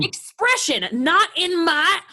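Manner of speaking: high, loud, emphatic reprimand; angry scolding. Acted. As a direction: forbid it forcefully. Violent quarrel, mother scolds son.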